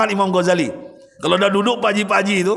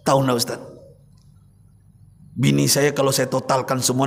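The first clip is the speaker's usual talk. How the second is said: put-on lower, subdued voice of a tearful old man; mild tearful confession, lower pitch; weeping old man; 5 semitones lower, 3 dB quieter than usual. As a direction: voice the crying rich elder, held low. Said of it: "menangis beliau sambil berkata".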